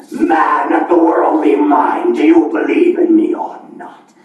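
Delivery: low booming ghost voice; a deep demanding spectre; playing both parts.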